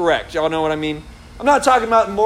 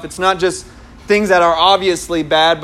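usual speaking voice

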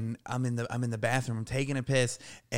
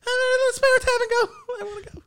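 very high, comic whimpering falsetto; mock-terrified high plea to leave; panicked squeal; 6 dB louder than usual. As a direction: flip into a squeaky coward voice. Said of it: "I went from, like ... to, like".

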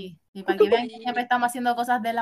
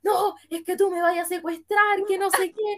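panicked passenger voice; 8 semitones higher than usual. Retold or imitated. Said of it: "Y dijo".

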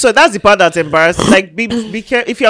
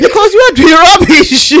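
high, loud, laughing rebuke; gleefully shouted put-down; mock scolding; 11 semitones higher, 7 dB louder than usual. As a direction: holler the telling-off through laughter.

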